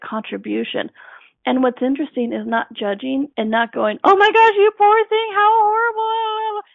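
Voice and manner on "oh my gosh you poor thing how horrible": much higher, louder, overdone gasp of pity; gushing mock pity. Mocking someone who dumps pity on victims, high gushing wail. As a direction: ham up the pitying bystander who smothers them. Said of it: "dumping that all onto the people".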